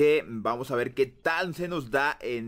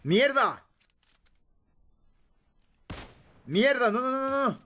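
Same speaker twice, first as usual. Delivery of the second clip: frantic shouting; 8 semitones higher than usual. Real, not acted. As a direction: yell it as everything goes wrong. Under fire, losing the fight.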